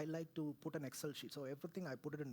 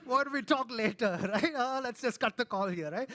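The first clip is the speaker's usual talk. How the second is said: much louder and higher, laughing through it; caller laughing; 6 semitones higher, 13 dB louder than usual. Retold or imitated. A stranger on the phone laughing off a sobbing caller. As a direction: brush him off with laughing disbelief. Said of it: "They're like".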